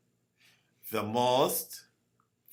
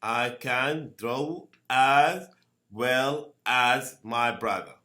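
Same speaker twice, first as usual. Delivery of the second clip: "I can draw as well as my brother" spelled out slowly; slow teacher dictation; slow model sentence. Shown as a demonstration. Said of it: "Okay, the same".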